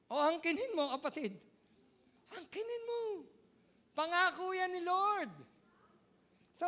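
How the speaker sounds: loud, high preaching cry; impassioned preaching. Acted. Pastor crying out to the congregation, impassioned. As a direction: proclaim it to the whole congregation.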